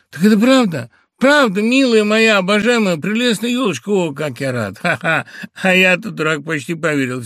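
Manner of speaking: high, loud joyful relief; overjoyed. Acted. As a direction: burst with happy relief. (радостный муж ликует)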